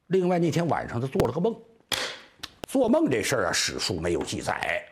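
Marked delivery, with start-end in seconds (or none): none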